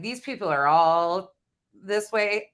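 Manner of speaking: usual speaking voice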